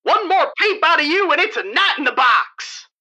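fast, hard-edged guard voice; tough prison guard. Acted. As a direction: bark it like a mean warden.

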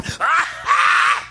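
high, breath-starved wheezing laugh; dirty old cackle. Retold or imitated. Imitating friend's real Act One laugh, dirty wheezing cackle. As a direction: imitate helpless laughter with no air left.

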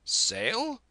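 high, questioning squire voice; hearty squire. A narrator's reading. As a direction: echo the word with amused surprise. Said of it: "says he".

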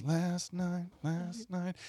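soft, fading echo effect; imitated echo, dropping away; delay echo. Shown as a demonstration. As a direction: repeat it quietly like a delay pedal. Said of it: "there's a delay where it goes".

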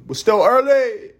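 very high, loud singsong with last word held; silly high-pitched holler; high mock cry. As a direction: squeal it playfully and stretch the end. Playful exaggerated repeat of own line.

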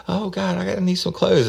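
falsetto panicked woman pleading; high mock wail. Detective imitates the suspect pleading innocence, falsetto whine. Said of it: "And then, then".

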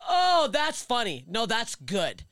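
imitating a dismissive burst of laughter, then high drawn-out words; put-on guffaw into a patronizing "that's funny"; mocking laughter. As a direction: laugh it off like the idea is ridiculous. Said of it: "Bursts out laughing".

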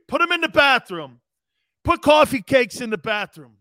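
shouting the famous line, hammed-up; movie quote shout. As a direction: belt the quote like the scene. Reenacting movie scene.

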